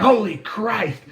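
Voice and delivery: high startled gasp of disgust; mock-shocked border guard; shocked exclamation. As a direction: recoil from the stink like the guard. Guard recoils from the smell.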